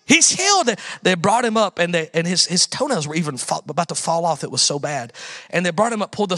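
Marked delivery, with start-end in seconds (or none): 0.0-0.7 high, excited shout of a mother; mother crying out that her son is healed; mom's cry of joy. Retold or imitated. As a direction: call it out like a thrilled mom. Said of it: "she's like".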